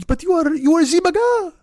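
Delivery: high-pitched mock French disbelief; shocked French accent. French passenger repeating accusation to driver, shocked French accent. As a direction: squeal it in an exaggerated French accent. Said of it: "he almost gasped".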